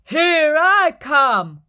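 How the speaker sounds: loud, slow, high shout; big goat charging the troll loudly. Acted.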